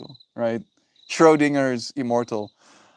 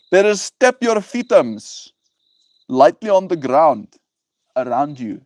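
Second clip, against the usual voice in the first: put-on pushed South African accent, higher and swinging in pitch; 5 semitones higher, 4 dB louder than usual. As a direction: threaten playfully in a thick South African accent. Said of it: "pushing the South African accent".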